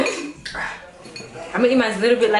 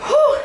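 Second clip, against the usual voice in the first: high whew; 6 dB louder than usual. Real, not acted. Reacting to spicy heat.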